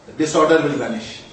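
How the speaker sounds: ordinary talk, nothing marked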